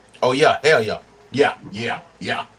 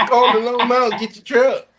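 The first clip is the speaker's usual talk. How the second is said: higher, pushy salesman voice; imitating a truck ad guy; 8 semitones higher than usual. Imitating a truck dealer ad to fellow driver, salesman pitch. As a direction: sell the truck like the ad. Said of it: "That's exactly how that motherfucker sounds".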